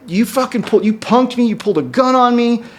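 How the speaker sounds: high-pitched, mock-outraged confrontation; retold indignant accusation. Retold confronting her about the gun.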